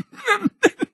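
put-on wheezy high old-man laugh; high chuckle. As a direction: laugh like a delighted grandpa. Grandpa laughing.

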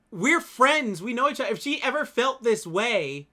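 high, loud and drawn out; worked-up defence of a friendship; indignant insistence. Real, not acted. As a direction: spell it out emphatically, exasperated.

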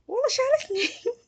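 very high, loud disbelief; shocked outcry. Real, not acted. Gamer kicked out by the house owner, crying out in shock. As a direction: cry out at being kicked.

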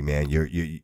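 normal talking voice

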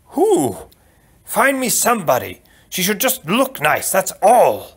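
high, insistent outburst; demanding prince. Acted. Prince ordering a new bride found, insistent.

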